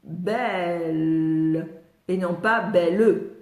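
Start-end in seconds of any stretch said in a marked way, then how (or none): 0.0-1.7 "Belle" low, its L lingering; "Belle" as one long falling syllable; lowering the tongue. Shown as a demonstration. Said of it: "je baisse ma langue". 2.6-3.3 "belle" broken into bè-leu; "belle" with a full second syllable, leu; two syllables. Shown as a demonstration. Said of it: "deux syllabes".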